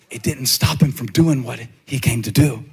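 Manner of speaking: usual speaking voice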